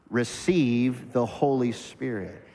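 Receive the Holy Spirit drawn out slowly; slow call-and-response. Shown as a demonstration. Pastor leads the congregation aloud, slow and deliberate. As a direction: lead the room to say it slowly together. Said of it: "everybody say it out loud".

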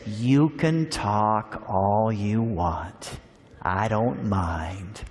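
put-on old Matthew kindly telling Anne to talk all she wants; gentle old man. God's answer in Matthew's voice.

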